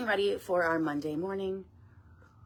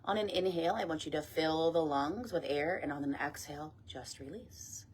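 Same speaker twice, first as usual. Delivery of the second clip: guided meditation; 6 dB quieter than usual. Acted. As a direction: ease them gently down, phrase by phrase. Leads meditation and breath work.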